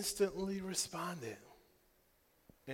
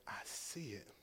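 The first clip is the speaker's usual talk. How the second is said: whisper; 4 semitones lower, 8 dB quieter than usual. Acted. Faith's voice, hushed.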